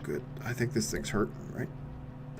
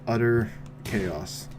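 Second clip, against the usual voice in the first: ordinary delivery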